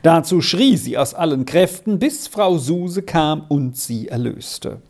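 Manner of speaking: ordinary delivery, nothing marked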